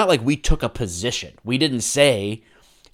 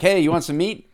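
upbeat, higher-pitched friendly offer; casual offer; 6 semitones higher than usual. Shown as a demonstration. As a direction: toss it out like an easy invitation. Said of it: "totally different when you say, like".

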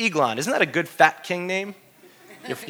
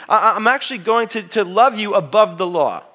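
ordinary talk

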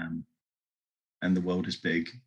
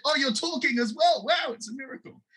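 high, wide-eyed wonder, voicing the family; amazed exclaiming. Household hearing the mute man speak, high amazed voice. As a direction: marvel at it, pitched way up.

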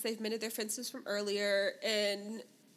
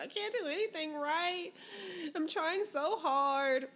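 higher-pitched, lightly imitated crying plea; mock tearful; 6 semitones higher, slower than usual. Recapping the wife's crying to a co-host, mock tearful. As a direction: retell it as a mild whine through tears. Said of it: "Now she's crying and she's like".

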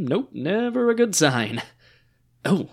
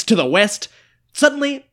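normal talking voice

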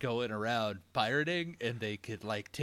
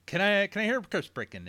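quick auction call, pitched up; auctioneer; 6 semitones higher, 5 dB louder, faster than usual. Retold or imitated. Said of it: "It's like an auctioneer".

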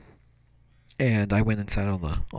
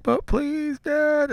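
put-on very high whiny kid begging; mock-crying, high-pitched beg; imitated tearful pleading; much slower than usual. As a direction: plead like a child about to cry. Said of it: "And I started crying".

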